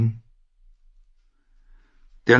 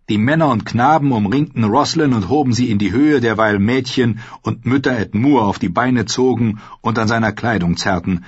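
plain reading voice

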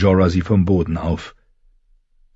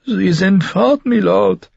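slow, much higher voice with a hint of tears; lightly tearful; 12 semitones higher, slower than usual. A narrator's reading. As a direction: tell him they are gone, near weeping. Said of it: "Dorea weinte". (leise weinerlich, nur angedeutet)

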